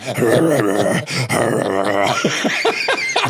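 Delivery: imitated snarling creature growl; mock growls of a sun-shy creature; creature noises. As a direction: make low rolling monster noises while joking. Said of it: "I was like those creatures in I Am Legend".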